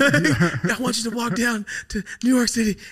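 high-pitched, laughing through the words; laughing mock quote. Mocking an imagined [name] request, laughing and high. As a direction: lift the voice and laugh while quoting. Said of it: "I can only imagine just".

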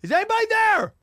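high, loud call into the dark; retold yell. Alone in a haunted hotel, calling out to ghosts, loud yell. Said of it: "I was, like, yelling out".

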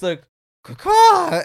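high loud crow caw; bird caw. Retold or imitated. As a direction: squawk like a bird. Mimicking bird hitting window.

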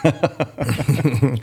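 a real laugh breaking into the answer. Real, not acted. Runner laughs telling the host just run a lot, laughing. Laughs while giving advice.

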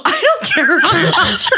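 high, loud, squeaky "I don't care" breaking into laughter; squeaky mock Drew. Teasing Drew at an Instagram event, a squeaky laughing squeal. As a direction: squeal the line like a silly impression. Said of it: "And Drew's like".

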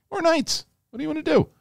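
silly high voice. Pretend.

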